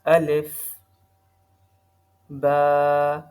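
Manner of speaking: each letter recited twice, slow and firm, with جیم sung long; tajweed drill of the alphabet; letter recitation. Shown as a demonstration. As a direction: recite every letter twice for pupils to repeat.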